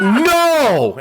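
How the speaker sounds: high, loud no; loud dismay. Retold or imitated. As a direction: shout it in dismay. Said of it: "just as loud".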